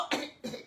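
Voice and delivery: soft throat-clearing demo; demonstrated throat-scratching cough. Shown as a demonstration. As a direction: show a small scraping cough. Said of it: "You have to go".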